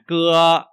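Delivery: loud, even, long cưa; cưa held level. Shown as a demonstration. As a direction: sustain cưa on one pitch. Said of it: "đều ngang ra". (cưa đều ngang)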